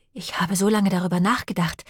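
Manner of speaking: ordinary delivery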